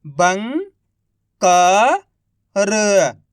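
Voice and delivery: each syllable drawn out very slowly; slow syllable-by-syllable reading. Shown as a demonstration. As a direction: sound out सुरंग slowly as सु-रं-ग, one sound at a time. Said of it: "एक अक्षर का उच्चारण करके".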